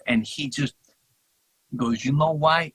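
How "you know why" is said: imitating a thick Greek accent, higher and louder. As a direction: put on a heavy Greek accent. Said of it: "In a thick, thick accent".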